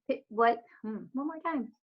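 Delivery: plain reading voice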